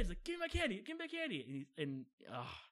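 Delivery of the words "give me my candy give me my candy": put-on very high, squeaky child voice; demanding little kid. Three-year-old wanting candy back, squeaky demanding. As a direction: whine for it like a tiny child.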